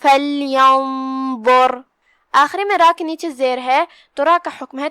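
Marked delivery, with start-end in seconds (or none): 0.0-1.8 فَلْيَنظُرْ slow, nasal ghunna hidden on the noon; ikhfa demo. Shown as a demonstration. Teacher demonstrating ikhfa to students, slow recited word. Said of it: "تو ہم یہ حکم کو ایسے ادا کریں گے".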